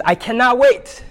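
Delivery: high, eager burst of impatience; excited elephant. Acted. Pastor voicing Elephant told of a surprise, bursting excited. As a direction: squeal it like a kid who can't hold still. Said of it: "'cause he's so excited".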